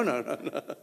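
normal talking voice

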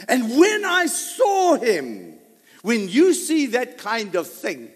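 loud, high preacher voice, drawn out; fiery sermon delivery; impassioned preaching. Acted. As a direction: cry it out from the pulpit. Preaching at full force.

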